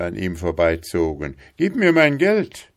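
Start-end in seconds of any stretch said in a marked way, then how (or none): 1.6-2.7 high, raised demand; shouting. A narrator's reading. Sentry demanding money from the passing farmer, shouting. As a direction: shout for what is owed. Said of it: "schrie der Posten".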